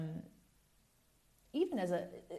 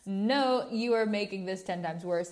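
exasperated retort; 7 dB louder than usual. Retold or imitated. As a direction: snap back, fed up with being told to calm down. Retort to being told calm down.

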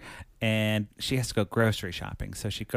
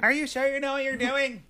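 much higher anxious question; worried girlfriend. Retold or imitated. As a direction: squeak it out, nervous for him. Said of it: "And she's like".

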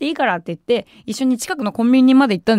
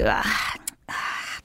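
put-on drunken groan; 9 dB quieter, slower than usual. Drunk young man groans on the bridge.

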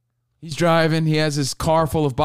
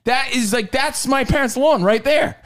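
usual speaking voice

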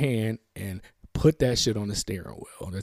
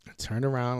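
ordinary delivery

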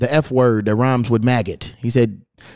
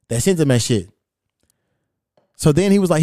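ordinary delivery

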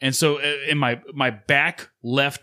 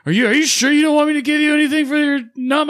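hammed-up dentist voice, much higher, louder and fast; mock dentist; 7 dB louder, faster than usual. Dentist pressing the patient to get numbed, in a high mock voice. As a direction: squeak it out like a fussing dentist.

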